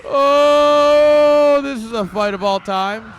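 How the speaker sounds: loud, high, ecstatic yell; excited screaming. Real, not acted. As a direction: scream the fight like it's history. Caster screams during big fight.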